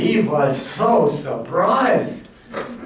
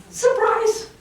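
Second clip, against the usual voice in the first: yelled surprise. Acted. Jack yells from drawer.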